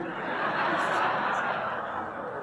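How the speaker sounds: soft breathy laugh that escapes. Real, not acted. Preacher laughing at his country music joke, soft breathy laugh. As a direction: laugh quietly at your own joke. Speaker cracks up at own joke.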